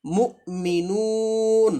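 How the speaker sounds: মুমিনুন chanted very slowly, vowels held; drawn-out Quranic reading of মুমিনুন; slow recitation demo. Shown as a demonstration. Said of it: "শুরুরটা দেখেন, এই যে".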